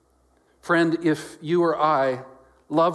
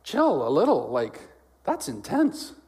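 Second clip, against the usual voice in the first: taken-aback objection; 2 semitones higher, slower than usual. Acted. Reader protesting to Jesus about his sternness, mock taken aback. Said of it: "Jesus says something so sternly, you're like".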